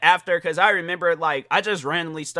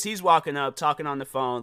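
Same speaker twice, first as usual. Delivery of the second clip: ordinary talk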